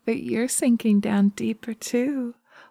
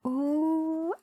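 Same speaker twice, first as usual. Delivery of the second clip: delighted gasp; 10 semitones higher, 5 dB quieter than usual. Acted. Witch delighted at the blush.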